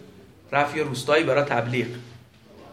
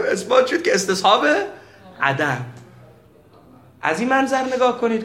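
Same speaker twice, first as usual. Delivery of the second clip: ordinary talk, nothing marked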